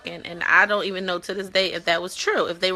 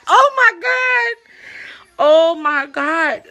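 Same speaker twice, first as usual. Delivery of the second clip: high, slow, stretched-out oh my God; scandalized slow-motion reaction; drawn-out shocked gasp; 9 semitones higher, 6 dB louder, much slower than usual. Real, not acted. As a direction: squeal it out slowly in disbelief.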